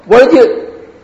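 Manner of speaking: put-on sudden high, loud snap at the child; angry parent yell. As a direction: start yelling at the child, then stop short. Parent yelling at messy child.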